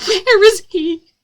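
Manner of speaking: high, loud, drawn-out tearful question; choking back tears. A narrator's reading. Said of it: "I choked back tears".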